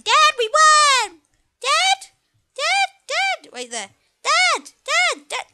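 put-on a boy trying to get his father's attention; child calling out. Son calling his dad after the finish, a high, insistent kid's call.

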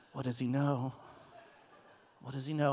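low, worried aside to oneself; suspicious child wondering. Retold or imitated. Children walking away confused by father's question, wary. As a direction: drop low, puzzling over what dad knows.

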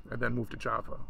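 ordinary delivery

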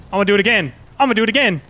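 high, loud, rising excitement; excited shout. Real, not acted. About to repeat the challenge.

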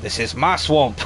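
hammed-up Scottish Shrek exclamation; terrible Scottish accent. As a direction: declare it in a badly faked Scottish accent. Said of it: "that's a terrible Scottish accent".

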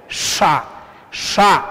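"sha" with a retroflex SH, each one separate. Shown as a demonstration. Teacher demonstrating the retroflex sound to learners, retroflex SH. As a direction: curl the tongue tip back for "sha". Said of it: "es retroflejo".

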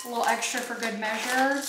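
ordinary talk, nothing marked

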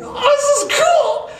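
high and slow, laughing with delight; overjoyed high-pitched exclamation; thrilled. Retold or imitated. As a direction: let it ring out high with a laugh. Musician thrilled by added drummer.

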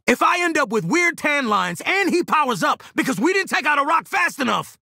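high-pitched, worked-up complaint; strained high rant to the team; agitated rant. Acted. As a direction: holler the gripe over the fight. Griping loudly mid-fight.

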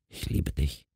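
very soft, low murmur; barely audible. A narrator's reading. Wife says goodbye to her husband, barely audible. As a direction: let it almost vanish. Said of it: "verabschiedete sie sich kaum hörbar".

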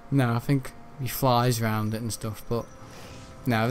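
ordinary talk, nothing marked

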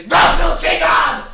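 loud, high, slow furious yell; angry shout. Real, not acted. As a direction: scream it in rage. Outburst during the game.

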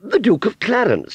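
very high, loud aunt voice; explaining grandly. Acted. Aunt Sally explaining the title to Wurzel, grandly. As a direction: explain it with haughty pride. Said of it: "she explained".

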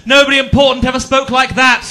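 high, loud scolding mother; mum's mock rebuke. Mother scolding her son, high and loud. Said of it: "and went".

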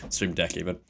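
ordinary talk

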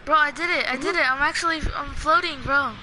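loud, high, thrilled yelling; excited. Real, not acted. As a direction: shout it out with delight. Excited about floating in game.

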